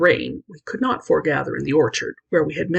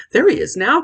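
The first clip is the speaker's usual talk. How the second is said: high, loud excited call from the window; excited cry; 8 semitones higher, 6 dB louder than usual. A narrator's reading. Boy at the window spotting Jerry, excited cry. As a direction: shout it out, thrilled to see him. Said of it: "cried Dan".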